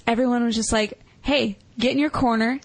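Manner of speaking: ordinary talk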